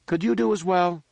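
high-pitched challenging question; younger brother's voice. A narrator's reading.

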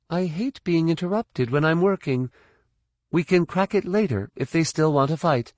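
high-pitched, irritated fighter's voice. Acted. Fighter interrupted at work on a hatch, irritated and high-pitched. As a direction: complain high and tough, mid-job.

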